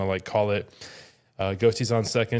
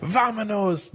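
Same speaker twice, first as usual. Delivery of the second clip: mock Spanish accent; 11 semitones higher, slower than usual. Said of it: "That was so funny".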